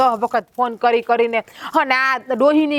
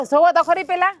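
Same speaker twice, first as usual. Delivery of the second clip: ordinary delivery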